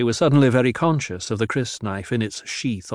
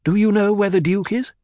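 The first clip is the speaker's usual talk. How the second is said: higher, louder demand; 10 semitones higher, 5 dB louder than usual. A narrator's reading. Jessica demanding the Duke's whereabouts, sharp. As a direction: press the guard hard. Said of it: "she demanded".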